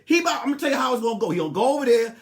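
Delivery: ordinary talk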